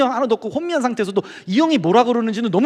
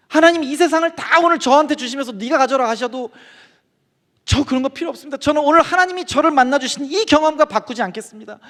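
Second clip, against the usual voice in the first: high-pitched, fervent plea; tearful confession; 7 semitones higher than usual. Retold or imitated. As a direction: reenact a sobbing confession of faith. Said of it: "하면서 거기서 막 울게 된 거예요". (울먹이며 간절하게 고백하듯)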